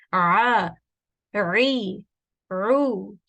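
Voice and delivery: constricted Ah, ri, ru demo; teacher drilling ain with its vowels. Shown as a demonstration. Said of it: "this ain is coming from the middle of the throat".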